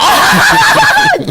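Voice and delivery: high, loud burst of laughter. Real, not acted. Host tickled by the GoFundMe mention, loud laugh.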